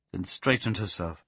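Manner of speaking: ordinary delivery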